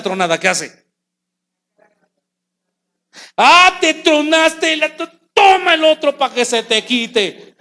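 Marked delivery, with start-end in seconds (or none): 3.4-7.5 mocking, higher and louder brush-off; a careless shepherd mocking the hurt lamb; dismissive. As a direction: shrug the injured sheep off.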